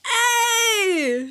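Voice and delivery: high, exuberant holler; excited cry. A narrator's reading. Jubilant suitor reacting to her reply, excited cry. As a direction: cry out in high-pitched delight. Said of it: "he cried".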